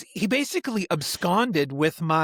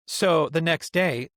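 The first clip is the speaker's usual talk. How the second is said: ordinary delivery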